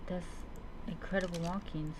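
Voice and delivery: normal talking voice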